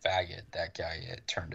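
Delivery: unvoiced whisper. Real, not acted. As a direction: drop to a whisper. Lowered voice on a slur.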